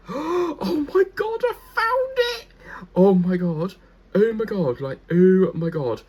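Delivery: high, loud gasping excitement; excited shriek. Real, not acted. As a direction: shriek with delight at the find. Finds the Skegness story.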